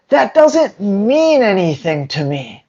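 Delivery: normal talking voice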